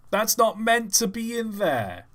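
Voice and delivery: hammed-up indignant whine; mock indignation. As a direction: repeat the huff. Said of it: "repeated line".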